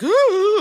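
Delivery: high, loud mock "ooh"; high hoot of retold nerves; nervous "ooh". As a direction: let out a high, relieved "ooh". Nervous exhale once officer leaves.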